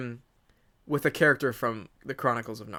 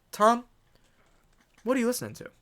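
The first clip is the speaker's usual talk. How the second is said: higher playful casual voice; mock casual question; 10 semitones higher, faster than usual. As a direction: toss off a casual question, pitched up. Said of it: "I'll be like".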